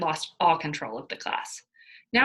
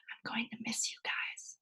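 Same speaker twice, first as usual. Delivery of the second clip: soft unvoiced whisper; 5 semitones higher, 10 dB quieter than usual. A narrator's reading. Mia whispering goodbye to the pennies, hushed and unvoiced. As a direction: breathe it out, barely voiced. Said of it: "I whispered".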